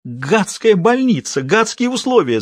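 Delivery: high, slow indignant grumble; old complaint. A narrator's reading. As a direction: harp on the same grievance. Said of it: "завела старую песню её сестра".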